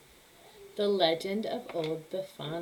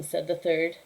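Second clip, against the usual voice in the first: usual speaking voice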